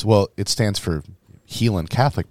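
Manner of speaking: ordinary talk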